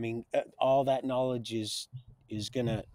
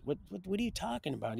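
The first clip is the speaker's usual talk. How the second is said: fast, higher, mock disbelief; baffled friend; 9 semitones higher, faster than usual. Neighbor baffled at Roy, imitated quick disbelief. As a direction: rattle it off incredulously.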